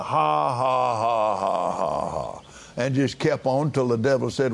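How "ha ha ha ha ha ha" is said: imitating a forced laugh. Preacher imitating Brother Hagin laughing at symptoms, mock laugh. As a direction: act out a deliberate laugh at his troubles. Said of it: "he just started doing that".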